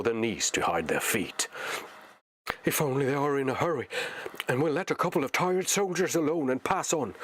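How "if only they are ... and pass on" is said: higher, fast anxious hope; Sam's hopeful thought. Acted. Sam hoping the orcs pass by, fast higher anxious hope. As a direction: hurry the wish out under your breath. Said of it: "he thought".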